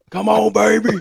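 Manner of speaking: high, loud hype shout; barking call-out. Retold or imitated. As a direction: bark it out to the crowd. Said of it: "and he's just like".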